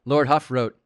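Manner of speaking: ordinary talk, nothing marked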